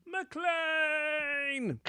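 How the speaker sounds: name held long on a high note; playful shout-out. Pretend.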